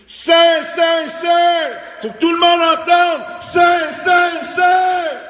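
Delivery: high, loud shout of « saint, saint, saint ». Shown as a demonstration. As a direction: cry it out so the whole sky hears. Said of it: "Ça doit certainement crier". (crier « saint » à pleine voix, très haut et très fort)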